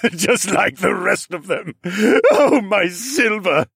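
slow, high, loud mad wail with cackles; crackpot cackle. Acted. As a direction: draw it out between crazed laughs. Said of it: "He cackled like the crackpot".

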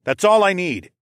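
loud, insistent command; ordering. A narrator's reading. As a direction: settle the argument with an order. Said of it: "I ordered".

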